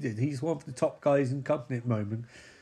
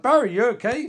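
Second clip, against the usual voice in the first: mock concerned call; 8 semitones higher, 7 dB louder than usual. Character asks Barry in retelling.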